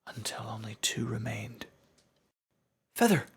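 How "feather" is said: high, loud calls to lost friends; high loud cries of joy; crying out. Acted. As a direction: reach out to them with your voice. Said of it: "I cried".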